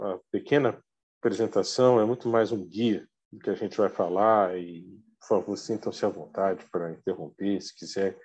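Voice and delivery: ordinary talk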